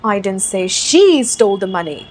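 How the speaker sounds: slow demo sentence, strong emphasis on "she"; stress on SHE. Shown as a demonstration. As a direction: punch the word "she". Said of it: "अब focus डालते हैं she पे".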